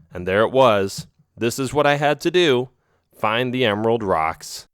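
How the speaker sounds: usual speaking voice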